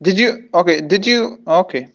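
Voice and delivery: normal talking voice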